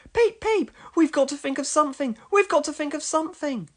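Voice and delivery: very high, fast, fussing little engine; high-pitched flustered engine voice; Thomas. A narrator's reading. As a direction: squeal it in a hurried panic. Thomas fusses in a panic.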